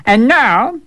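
sly servant. Acted.

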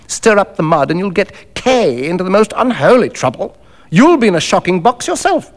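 lightly acted hard-headed accomplice; Macfarlane, cynical and insistent. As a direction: argue the case with knowing cynicism.

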